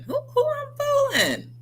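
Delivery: squeaky mock doubt. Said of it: "get mad at yourself and say".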